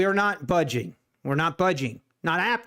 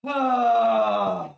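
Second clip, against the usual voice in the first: high, drawn-out wail of disbelief; 5 semitones higher than usual. Real, not acted. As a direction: let out one long, high cry. Stunned by the near miss on stream.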